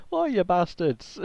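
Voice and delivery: mock yell down the road at enemies; half-shouted taunt, drawn out slowly. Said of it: "you're just yelling down the road".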